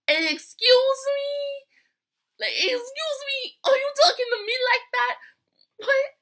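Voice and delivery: high, loud, drawn-out "excuse me"; hammed-up offended diva; sassy outrage. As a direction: caller answering the rude manager with mock outrage. Offended reply to rude manager.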